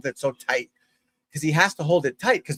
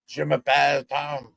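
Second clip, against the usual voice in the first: light mock French accent, slower at the end. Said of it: "I would love to have a French accent".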